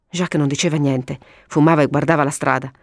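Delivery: usual speaking voice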